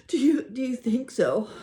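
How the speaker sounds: hopeful, tear-choked question; tearful hope. A narrator's reading. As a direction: ask through tears.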